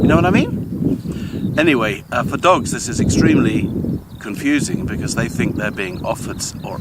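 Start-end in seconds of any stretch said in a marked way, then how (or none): 0.0-1.5 much higher, ending on a sharp rising lilt; mock uptalk. Shown as a demonstration. Lecturer mimicking uptalking speakers, mock rising tune. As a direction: parody the uptalker's endless upward question tune. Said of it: "every sentence ends on a rising intonation".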